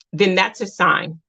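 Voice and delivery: ordinary talk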